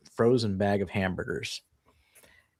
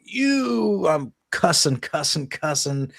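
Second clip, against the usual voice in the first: high, drawn-out "you" falling into cussing; mock angry cussing; 6 semitones higher, 3 dB louder, much slower than usual. Husband cursing at a stuck hamburger bag, mock angry. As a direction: re-enact a furious outburst with stand-in swearing. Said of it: "starting to get angrier and angrier".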